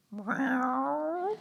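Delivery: high meow in a cat voice; the dog shows it is bilingual with a cat's meow. Retold or imitated. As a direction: mew like a cat. Dog's punchline bilingual answer.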